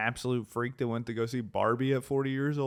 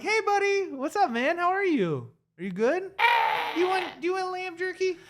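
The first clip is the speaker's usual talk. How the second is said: talking to a dog. Shown as a demonstration. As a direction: coo at your dog, way up high. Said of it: "Pretend like I'm your dog".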